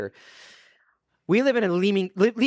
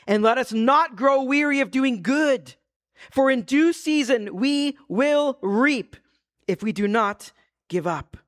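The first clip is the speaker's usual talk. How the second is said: scripture reading; 8 semitones higher than usual. Acted. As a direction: lift the verse up to the room. Reads scripture aloud.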